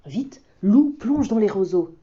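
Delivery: plain reading voice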